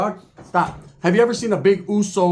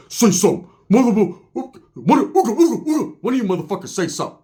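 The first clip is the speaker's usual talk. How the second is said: angry Uso; 5 semitones higher than usual. Retold or imitated. Shamed Uso daring the others to speak up. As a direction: bark it like he's daring them. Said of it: "He's like".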